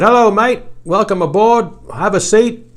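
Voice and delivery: imitated Australian accent; mock Australian pilot. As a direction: greet him like a cheery Australian pilot in a put-on accent. Pilot greets him in cockpit.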